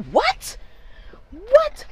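very high-pitched mock-outraged yelp; retold mad disbelief. Mom retelling her reaction to son refusing, mock-outraged yelp. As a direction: shoot up high in indignant disbelief. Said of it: "I was so mad".

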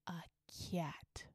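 very soft, low "a cat"; quiet, ominous reveal; hushed reveal. Retold or imitated. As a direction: drop "cat" almost to nothing.

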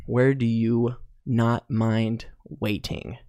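slow and deliberate, as if putting the question to the audience. Shown as a demonstration. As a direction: read the question out very slowly. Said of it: "but it is".

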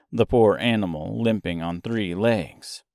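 plain reading voice

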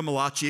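Malachi said as "ma-LAH-chee"; Malachi with the middle syllable stressed, "ma-LAH-chee"; mock-Italian Malachi. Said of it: "okay, it's not Italian, it's Malachi".

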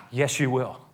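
ordinary talk

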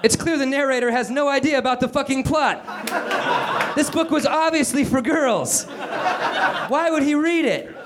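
high, strained character voice; exasperated complaint. Acted. Calvin griping to Meg about the narrator, high exasperated complaint. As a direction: complain in a high, pushed pitch.

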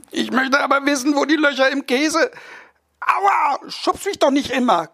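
put-on high, pleading child's voice that yelps at the shove; a small boy begging to know; whiny little boy. Boy nags his parents at the dinner table, high and whiny.

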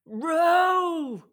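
high, loud long-drawn command; shout. Acted. Finn orders the sisters at the oars, shouting. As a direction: bellow the order, stretching the vowel high. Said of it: "shouted Finn".

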